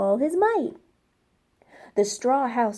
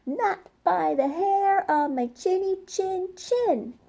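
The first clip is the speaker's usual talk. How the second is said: much higher little pig voice; the pig calling back through the door, pitched up; 8 semitones higher than usual. Acted. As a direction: answer the wolf like a frightened piglet. Pig answers the wolf.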